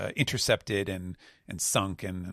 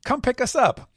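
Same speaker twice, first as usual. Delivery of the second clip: much higher, louder woman's call; Devon voice; 8 dB louder than usual. Acted. Devon hails Pizarro from the raft, high calling voice. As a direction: call out across the sea to the island. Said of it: "And she just says".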